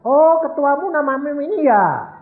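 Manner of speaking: high, loud knowing question; sudden realisation. Retold or imitated. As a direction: act out suddenly working it out. Retold realisation, confronting the men. (bertanya lantang karena baru tersadar)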